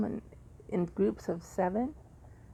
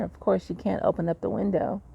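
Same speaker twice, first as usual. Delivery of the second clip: ordinary talk, nothing marked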